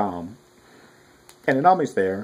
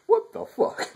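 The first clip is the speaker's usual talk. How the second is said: amazed what the fuck; slower than usual. Real, not acted. Astonished at teachers existing.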